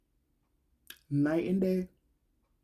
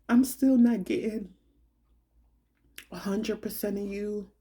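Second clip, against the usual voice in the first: ordinary talk, nothing marked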